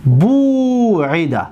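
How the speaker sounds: بو عیده drawn out, syllable by syllable; slow dictation; slow model word. Shown as a demonstration. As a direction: spell out بو عیده slowly. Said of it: "تو".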